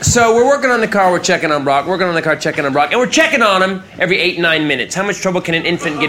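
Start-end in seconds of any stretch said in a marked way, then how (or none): none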